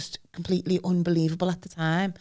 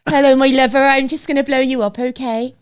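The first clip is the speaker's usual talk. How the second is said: friendly West Country burr, louder and higher; West Country accent; 5 semitones higher, 7 dB louder than usual. Retold or imitated. Podcaster voicing a cheerful West Country bomber, louder West Country accent. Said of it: "In a West Country accent".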